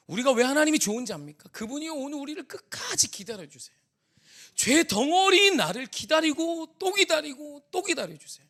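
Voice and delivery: high, loud, impassioned sermon delivery; full-force preaching. Real, not acted. Preacher urging the congregation on God's patient love, impassioned. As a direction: preach it out at the top of your voice.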